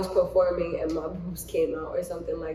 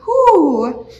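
exertion whoop; 9 semitones higher, 14 dB louder than usual. Real, not acted. Mid push-ups.

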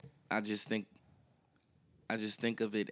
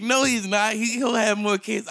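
laughing retort; 8 semitones higher, 6 dB louder than usual. Retold or imitated. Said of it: "And it was like".

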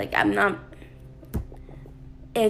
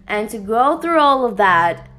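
normal talking voice

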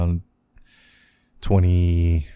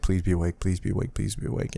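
pleading under breath; 8 dB quieter than usual. Retold or imitated. Desperate call after nightmare.

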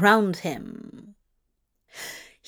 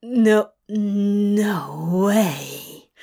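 shocked, half-breathed; breathless disbelief; 3 dB louder, much slower than usual. Acted. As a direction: gasp in disbelief. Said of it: "he breathed".

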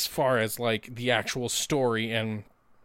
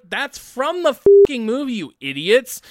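mocking exasperation; 8 semitones higher, 10 dB louder than usual. Mocking clueless internet people, loud high exasperated rant. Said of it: "they're like".

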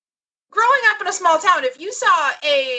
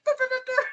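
siren noises; 9 semitones higher, 5 dB quieter, faster than usual. Retold or imitated. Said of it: "who was like".

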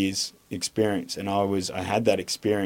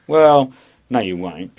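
1 emphatic rebuttal; 4 semitones higher, 10 dB louder, slower than usual. Real, not acted. Rebutting tough-guy claim.

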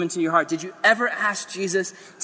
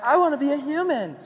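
lightly voiced high little mermaid; a hint of a wistful girl pleading; Ariel voice; 5 semitones higher than usual. Ariel pleading to the sea witch, lightly high. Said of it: "Ariel comes over and she's like".

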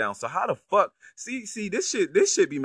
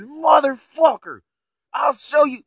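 loud, higher mock challenge to fight; imitated furious shout; 11 semitones higher, 9 dB louder than usual. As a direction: yell the threat, hammed-up. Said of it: "He said".

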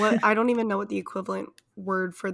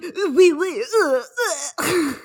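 high, loud mock French accent; badly faked French accent, full of disdainful grunts; terrible French accent; 6 semitones higher, 5 dB louder, slower than usual. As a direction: ham up a scoffing French person's accent. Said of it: "probably the worst French accent ever".

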